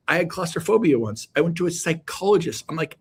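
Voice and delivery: ordinary talk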